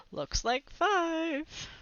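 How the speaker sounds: a bully sneering at kids in a hole, slow and mocking; smug bully. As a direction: drawl it out slowly like a smug bully.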